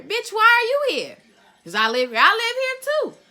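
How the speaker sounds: high, loud outburst of indignation; angry retort. Acted. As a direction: snap back at full pitch, outraged. Angry retort to being questioned.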